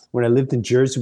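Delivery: normal talking voice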